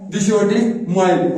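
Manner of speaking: very slow, clear model sentence; slow example sentence. Shown as a demonstration. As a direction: dictate it word by word. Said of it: "वहां पर हमारा क्या है?". (धीरे धीरे साफ़ बोला गया वाक्य)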